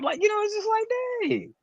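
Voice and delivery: high, soft squeaky voice. Pretend. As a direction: keep the squeak going, sheepish. Teasing in high voice.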